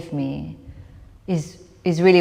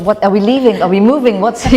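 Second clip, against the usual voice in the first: loud, rising panicked questions; a hint of a scared child's panic; alarmed questions; 3 semitones higher, 9 dB louder than usual. Child panicking as they leave.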